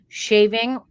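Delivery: ordinary talk, nothing marked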